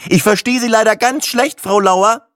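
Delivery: loud, high and irritated; exasperated, high-pitched near shout. Acted. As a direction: call pointedly down a bad line. Customer raises voice at clerk.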